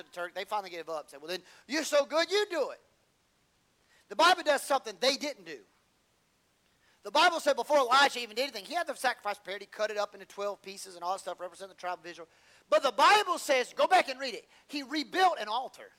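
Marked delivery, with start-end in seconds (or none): 1.2-2.8 mock taunt. Prophets give up, challenging Elijah. 4.1-15.9 loud, high preacher's build; preaching at full force. Acted. Preacher urging the congregation to read it, loud and high.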